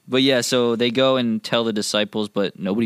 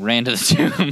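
laughing; much faster than usual. Shown as a demonstration. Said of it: "Just kidding".

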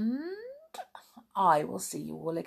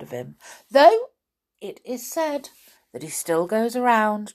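story narration; 2 semitones higher, 5 dB louder than usual. A narrator's reading. Narrator ending the tale, animated storytelling. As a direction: build the action, then settle down. Reads a fairy tale aloud.

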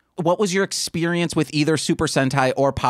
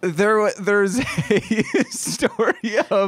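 higher, laughing through the words; 7 semitones higher than usual. Real, not acted. Host trying to tell the balls story, laughing. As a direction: struggle to get it out through laughter.

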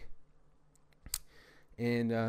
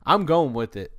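defiant declaration; 2 semitones higher, 7 dB louder than usual. Real, not acted. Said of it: "this is gonna be a horrible podcast".

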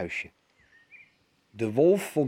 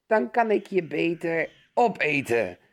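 wolvenstem; 5 semitones higher, 4 dB louder than usual. A narrator's reading. Wolf onthult zich.

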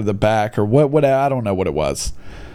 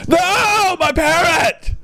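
very high shout of "No, my parrot!"; anguished cry; much slower than usual. Retold or imitated. Pirate watching his parrot escape, shouting high. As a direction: wail it as the bird flies off. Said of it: "He's like".

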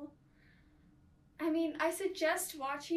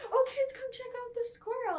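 put-on high, loud excited call to the kids; mock-excited voice, pitched way up; imitated amused dad; 9 semitones higher, 5 dB louder than usual. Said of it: "kind of guy to laugh at it and be like".

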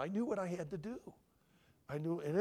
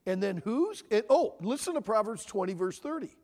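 ordinary talk

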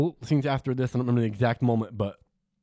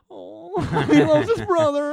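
very high, slow mock wail; hammed-up tearful gushing through a laugh; mock crying; 5 dB louder, slower than usual. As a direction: pretend to bawl over a touching scene. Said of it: "He could have done a better cry".

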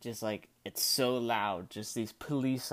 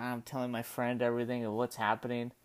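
usual speaking voice

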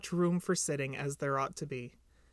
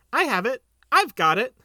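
high, loud burst of excitement; excited discovery; 7 semitones higher, 9 dB louder than usual. A narrator's reading. Piglet suddenly finds the thinking tree, excited cry.

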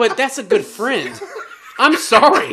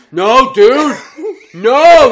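rising louder no, dude; insistent; 6 semitones higher, 8 dB louder, much slower than usual. Retold or imitated. Boy insisting to his doubting friend, louder. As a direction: push back hard at the doubter.

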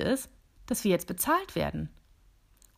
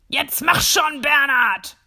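loud impatient bellow; 6 semitones higher, 9 dB louder, slower than usual. Acted. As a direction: roar at him to hurry. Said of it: "bis der Chef brüllt". (brüllend, ungeduldig)

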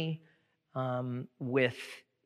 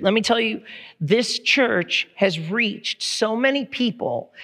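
loud, raised-pitch preacher urging the congregation; emphatic church exhortation; preaching; 5 semitones higher, 7 dB louder than usual. Real, not acted. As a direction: project with drive, pitched up.

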